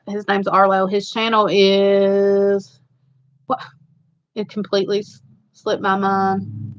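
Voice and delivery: ordinary talk, nothing marked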